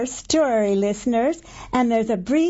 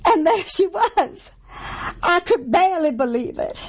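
ordinary delivery

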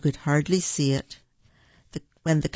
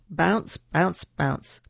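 ordinary delivery, nothing marked

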